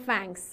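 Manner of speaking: "thanks" said as "fanks"; the wrong f sound on "thanks"; f instead of th. Shown as a demonstration. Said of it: "فی هم نگین".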